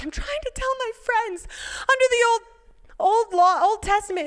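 very high, loud, emotional pleading; impassioned retelling. Retold or imitated. Friend trying to tell others about law, impassioned. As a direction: voice her urgency, pitched right up.

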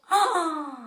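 a sharp startled gasp. Acted. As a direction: gasp as if waking to an empty party. Frog wakes, everyone gone. (suspiro de susto)

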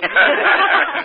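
a sudden high burst of laughter; hearty laugh. Acted. Ellery scoffs at the magician's vanishing claim, hearty laugh. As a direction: laugh out loud, amused and doubtful.

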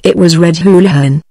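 ordinary delivery, nothing marked

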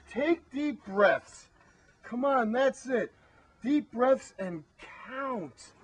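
slow, soothing coaxing; calming. Acted. Calming the two of them down, slow and soothing. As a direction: talk them down slowly and gently.

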